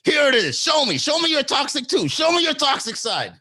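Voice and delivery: high, loud imitated taunting; mock goading yell. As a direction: goad them to show their worst, hammed-up. Voices her goading him to snap.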